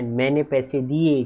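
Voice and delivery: normal talking voice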